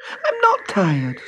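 put-on high, slow girl's voice; little girl voice. A narrator's reading. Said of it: "said Trudy".

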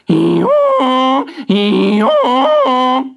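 long, high, loud donkey bray. Acted. Donkey braying at the box to scare Jack, very loud. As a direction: bray as loud as you can. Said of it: "he brayed his very loudest".

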